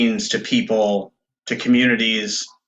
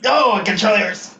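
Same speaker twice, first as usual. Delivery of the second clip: high, pained cry from a cramp; in real pain; 10 semitones higher than usual. Real, not acted. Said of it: "I know how painful that is".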